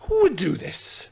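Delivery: high, slow appeal in mock dismay; mock outrage. Radio host reading out news of a shed theft, mock outrage. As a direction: lament it slowly, pitched well up.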